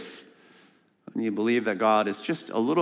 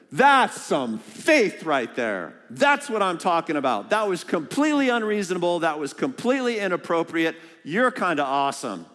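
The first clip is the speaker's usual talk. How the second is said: higher, thrilled, casual Jesus; excited Jesus; 7 semitones higher, 3 dB louder than usual. Acted. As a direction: cheer her on like a fan. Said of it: "way more exciting than your Bible".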